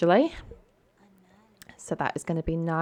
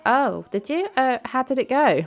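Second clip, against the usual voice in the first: lightly acted, higher-pitched interest; curious surprise; 8 semitones higher, 4 dB louder than usual. Brandy hears Nina told Dina, mildly surprised and curious. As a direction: perk up with a high, curious question. Voicing girlfriend reacting to the news.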